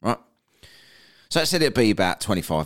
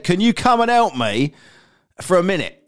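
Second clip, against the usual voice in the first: lightly acted high plea for help; struggling colleague; 8 semitones higher, 4 dB louder than usual. Stuck coworker asking a mate for help, mildly acted struggling plea. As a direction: imitate a coworker calling over, raised pitch. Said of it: "he's really in pain".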